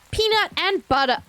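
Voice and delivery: normal talking voice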